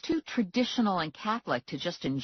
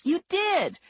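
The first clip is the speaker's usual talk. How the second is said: indignant; 5 dB louder than usual. A narrator's reading. Arguing with Morelli.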